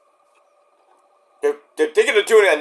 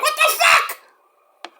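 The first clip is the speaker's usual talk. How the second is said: shocked; much slower than usual. Real, not acted. Shocked outburst about movie.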